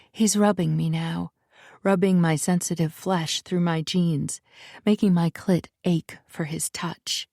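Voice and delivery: plain reading voice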